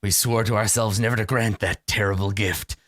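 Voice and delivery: low, grave vampire lord; brooding vampire. Acted. DM voices a weary vampire to the party, low and grave.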